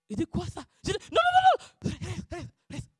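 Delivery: high, loud retold "non, non"; retold frightened no; mock frantic refusal. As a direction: wave him away in a mock panic.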